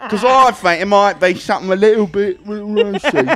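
high, loud, hammed-up British accent; mock British accent. Comic spoofing someone who talks British, mock British accent. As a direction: push a silly British accent until it cracks you up. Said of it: "if you're gonna talk British".

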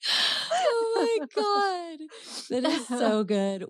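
high, drawn-out laughing wail; words stretched out through real laughter; helpless laughter. Real, not acted. Host cracking up at co-host's joke, helplessly laughing.

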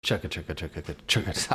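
low, soft chugga chugga; quiet train sounds. Pretend. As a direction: mutter it like a small chugging train. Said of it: "Jordan, go".

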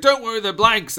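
high, loud, overconfident reassurance; hammed-up calm-down call; mock reassurance. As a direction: play a blustering officer insisting all is fine. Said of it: "He's like".

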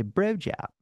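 "bro job" with fronted Baltimore vowels; Baltimore-accented bro job. Shown as a demonstration. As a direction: say blowjob as "bro jab", Baltimore style. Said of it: "Baltimore accent".

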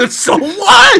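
high squeal of laughing disbelief. Real, not acted. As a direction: laugh it out, incredulous. Heated argument, laughing disbelief.

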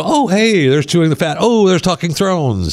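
high, chirpy mock delight; imitating a happy listener. Host imitates a subscriber getting podcast alerts, high mock delight. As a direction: play the eager fan spotting new episodes. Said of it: "And you go".

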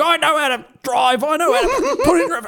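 put-on high, strained grumpy old bloke voice with a laugh breaking in; retelling the old driver who swore at him, cranky old man voice; mock angry old man. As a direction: play a cranky grandpa snapping back, half laughing. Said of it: "started swearing at me, saying".

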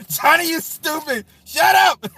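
high laughing yell; laughing shout. Real, not acted. Streamer laughing, yelling at a joking viewer. Yelling back at chat joke.

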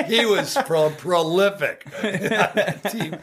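high laughter breaking through; laughing. Real, not acted. Telling the Dosh story, laughing high. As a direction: laugh as you say it.

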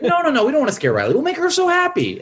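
very high, fast, insistent; manic Joy. Retold or imitated. Joy overruling Sadness, fast and squealing high. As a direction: rush it out in a high cartoon squeal. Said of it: "And you're like".

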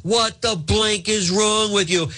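high mock yell at staff, slow and pressed; hammed-up angry boss barking orders; bellowing at his aide in mock fury. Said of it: "screaming at his staff".

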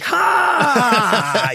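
high, stretched mock-laugh exclamation; loud drawn-out "ha". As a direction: burst out with one long high "haaa". Acts out his reaction to rumor.

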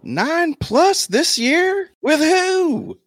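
squeaky, incredulous falsetto; high-pitched disbelief. Real, not acted. Podcaster scoffs at the nine-win prediction, squealing incredulously. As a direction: push the questions way up high in disbelief.

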